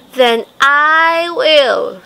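firm hen deciding to do it herself; slow determined hen. Acted. Hen resolves to do it.

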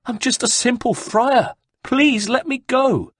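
high, pleading monk voice; desperate pleading. Acted. Beaten monk pleads with the guards, high and desperate. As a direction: beg for mercy through the pain.

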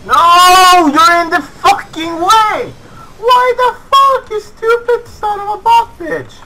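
very high, very loud rage; furious screaming. Real, not acted. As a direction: scream abuse at a useless teammate. Rages at bots blocking him.

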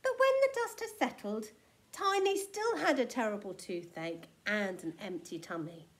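ordinary delivery, nothing marked

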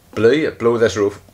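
usual speaking voice

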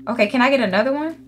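usual speaking voice

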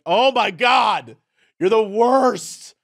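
slow, drawn-out mock outcry; exasperated. Reacting to friends who ditch you, exasperated. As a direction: stretch each word in exaggerated disgust.

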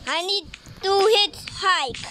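ordinary delivery

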